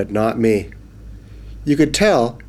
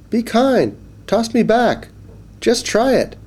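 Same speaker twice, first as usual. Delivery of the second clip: small high pleading fish; 12 semitones higher than usual. Acted. Fish pleading with the boy again, high and coaxing. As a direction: beg like a tiny fish, higher still.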